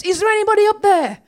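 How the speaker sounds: high, loud calls for help from the tree; a man stuck in a tree calls out for help; shouting for help. Retold or imitated. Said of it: "he suddenly shouts".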